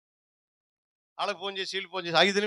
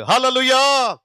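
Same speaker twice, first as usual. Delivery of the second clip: preacher's call; 4 semitones higher, 6 dB louder, much slower than usual. Acted. Preacher calls to congregation.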